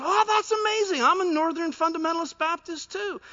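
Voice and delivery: high, loud delighted cry; overjoyed. Retold or imitated. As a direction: squeal with delight at the match. Overjoyed man in joke.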